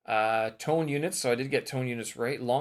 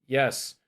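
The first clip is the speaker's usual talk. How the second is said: short, forceful YES; varied intonation; 3 semitones higher, 8 dB louder than usual. Shown as a demonstration. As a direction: punch out an emphatic "yes". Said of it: "as many ways as you can".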